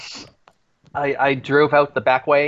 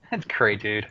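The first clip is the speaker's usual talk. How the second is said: put-on sulky teen mumble; 3 semitones lower, 6 dB quieter than usual. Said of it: "Why are you speaking like a teenager".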